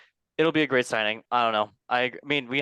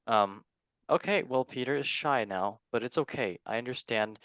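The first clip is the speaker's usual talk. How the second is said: normal talking voice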